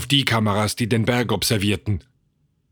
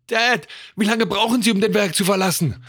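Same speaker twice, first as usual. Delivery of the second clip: put-on high, worried young voice; anxious child; 10 semitones higher than usual. A narrator's reading. Son asking Dad about escape time, anxious. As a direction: ask urgently, pitched up. Frightened son asks during emergency.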